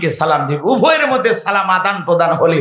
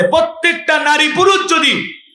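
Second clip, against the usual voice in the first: fiery preaching; 7 semitones higher than usual. Acted. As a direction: thunder it out to the crowd. Preaching at full force.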